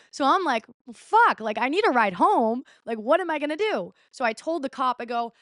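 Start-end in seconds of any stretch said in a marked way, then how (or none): 0.9-3.9 high, exasperated reenactment; retold panic. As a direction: act out being stranded, voice jumping up. Retold panic at being stranded.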